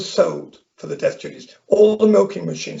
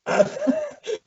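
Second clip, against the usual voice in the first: laugh; 4 dB quieter than usual. Real, not acted. End of funny story.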